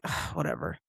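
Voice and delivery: low, quiet dismissive grumble; retold bad-mood brush-off, under the breath; sulky "whatever", voice dropped low and soft; grumpy. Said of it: "just in a bad mood".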